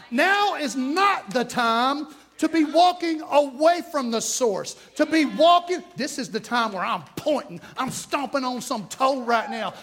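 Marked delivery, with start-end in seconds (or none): 0.1-5.8 slow, louder, higher preaching build; slow rising preacher's shout. Real, not acted. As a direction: drive it home at full force.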